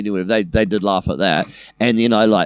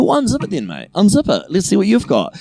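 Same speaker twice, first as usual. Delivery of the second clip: higher, cocky dare; a taunting, higher dare; daring challenge; 9 semitones higher than usual. Retold or imitated. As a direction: goad him to whip it out. Daring the bully to unzip.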